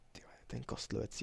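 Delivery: hushed whisper about the skeleton. Real, not acted. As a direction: breathe it out unvoiced. (šepot)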